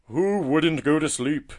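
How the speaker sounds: slow, high, loud exclamation; crying out. A narrator's reading. Tortoise delighting in his bed, slow loud cry. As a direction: cry it out, stretching the words. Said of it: "cried the tortoise".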